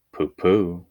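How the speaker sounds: a lightly voiced little girl, brushing it off; a small girl shrugging off a tiger, lightly done; dismissive little girl. A narrator's reading. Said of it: "Madeline just said".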